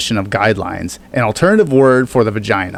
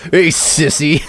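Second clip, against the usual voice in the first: eighties movie bully; 3 semitones higher, slower than usual. Pretend. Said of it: "bullies from the fucking eighties".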